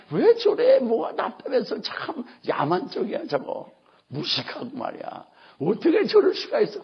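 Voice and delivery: mock disapproval, jumping high then dropping; disapproving onlooker. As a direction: grumble like a scandalized bystander. (못마땅해하는 구경꾼 흉내로)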